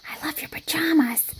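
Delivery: soft unvoiced whisper. A narrator's reading. Girl in the crowded thinking corner, whispered praise. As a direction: hush it so the teacher won't hear. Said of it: "Priscilla told everyone".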